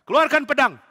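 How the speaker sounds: king commanding soldiers; barked command. Acted. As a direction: call the troops to attention. King repeats order.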